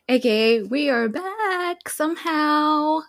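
high, drawn-out announcing; sing-song show intro. Real, not acted. Host reopening the show after a long break, sing-song.

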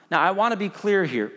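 ordinary delivery

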